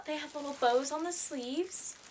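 normal talking voice

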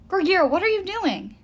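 high, loud cry of alarm; fearful, confused. A narrator's reading. Will challenges the nymph holding his boyfriend, fearful and confused. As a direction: demand it in frightened confusion. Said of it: "Will's voice was full of fear and confusion".

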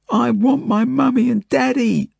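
very high, drawn-out howl; howling child. A narrator's reading. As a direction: howl it slowly like a distraught little girl. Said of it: "she howls".

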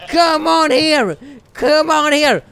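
ordinary delivery

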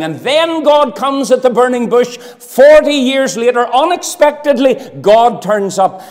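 booming revivalist preacher; preaching at full force. Acted. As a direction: drive it home from the pulpit. Preaching build to burning bush.

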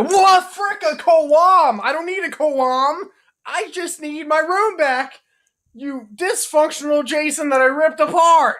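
high, loud indignant rant; outraged shout. Acted. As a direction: rant as if deeply insulted.

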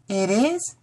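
put-on high-pitched little girl's amazement; gasped surprise. A narrator's reading. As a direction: ask it high, in childlike wonder. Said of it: "she gasped".

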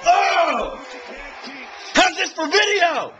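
high, loud, slow yell of delight; thrilled fan shout; excited shout. Real, not acted. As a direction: still buzzing from the score. Fan yelling after touchdown.

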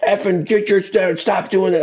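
put-on high, furious yell at the kids; a parent losing it on the sideline; angry yelling parent. A parent yelling at kids on the sideline, high, angry swearing.

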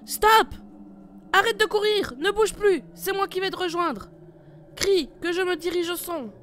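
loud, high barked orders; commanding shout. Acted. Leader ordering partner to freeze, loud commanding shout. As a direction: yell orders across the fog.

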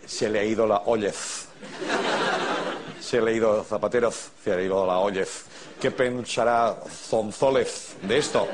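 endings turned into a lisped Z in "ollez"; mock the Z habit, "Zonzólez" with th sounds; exaggerated Z pronunciation. Host parodies Zapatero's campaign, mock th-heavy Z in "ollez". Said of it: "uso mucho la Z".

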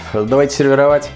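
normal talking voice

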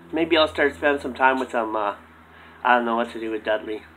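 low, soft puppet voice; low ventriloquist dummy. Acted.

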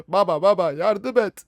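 slow, put-on little kid pleading; whiny child voice. Child begging his father for help, put-on whine. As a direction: beg like a scared small boy.

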